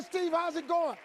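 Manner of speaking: high, loud cheery question; bright-and-early phone cheer; upbeat caller. Acted. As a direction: imitate an eager morning caller. Acted upbeat phone exchange.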